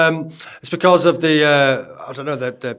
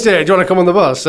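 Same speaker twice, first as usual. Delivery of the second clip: fast mock invitation; 4 semitones higher, 5 dB louder, much faster than usual. Imitating inviting a girl.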